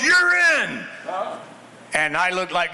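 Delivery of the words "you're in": high, loud barked order; coach's shout. Retold or imitated. Coach yelling at the backup quarterback to go in. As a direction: shout it like a coach sending him in. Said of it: "He goes".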